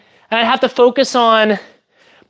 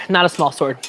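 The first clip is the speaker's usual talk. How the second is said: normal talking voice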